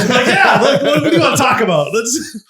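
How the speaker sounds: high, loud laughing talk. Real, not acted. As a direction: laugh through the words. Laughing at own story.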